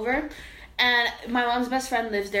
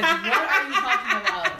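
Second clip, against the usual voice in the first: laughing disbelief; 6 semitones higher, 4 dB louder than usual. Retold or imitated. Retold disbelief at the woman.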